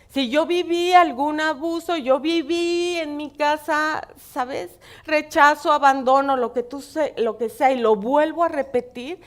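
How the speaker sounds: loud impassioned preaching, slowing on "viví"; preaching at full force. Acted. Pastor urging listeners about repeated family abuse, impassioned preaching. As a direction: drive each word home to the congregation.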